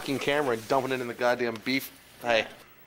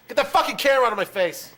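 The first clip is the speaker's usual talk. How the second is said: angry shout; 8 semitones higher, 3 dB louder than usual. Acted. Repeated takes, shouted.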